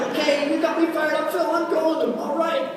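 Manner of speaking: high-pitched mock eagerness; fired up. New recruit telling Phil he's ready, fired up. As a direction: psych yourself up, pitched well up high.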